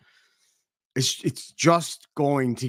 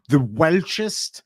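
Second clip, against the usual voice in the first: ordinary delivery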